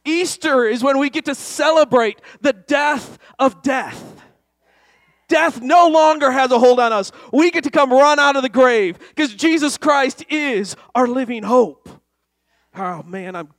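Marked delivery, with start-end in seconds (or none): 0.0-4.2 loud, high, punching shout; louder preached repeat; preaching shout. Shown as a demonstration. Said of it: "You guys didn't catch that". 5.3-11.9 loud, high, exultant preaching; full-force preaching. Acted.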